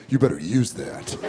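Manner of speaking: low, quiet movie tough-guy line; underplay it like an eighties action star; dropped-pitch, cool hero impression. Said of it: "they always say some cool shit like".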